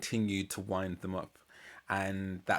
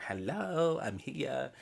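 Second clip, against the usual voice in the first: mock plea; 5 semitones higher, much slower than usual. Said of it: "be like".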